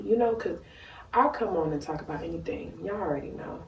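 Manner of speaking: ordinary talk, nothing marked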